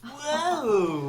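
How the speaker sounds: loud high whoa; excited whoa. Real, not acted. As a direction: gasp out a delighted whoa. Reacting to a big swap.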